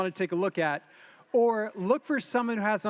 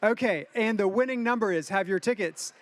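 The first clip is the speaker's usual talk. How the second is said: ordinary delivery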